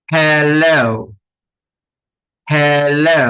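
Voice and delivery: হ্যালো slowly, long English "-lo" glide; drawn-out "he-looow" for হ্যালো; correct pronunciation. Shown as a demonstration. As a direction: model হ্যালো for repeating, last syllable stretched. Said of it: "কিন্তু জিনিসটা হবে হ্যালো".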